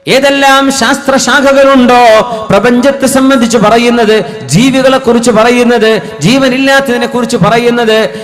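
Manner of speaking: sustained, projected sermon delivery; preaching. Acted. Preacher warning against reciting without reflection, preaching. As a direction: press the warning on a congregation, phrase by phrase.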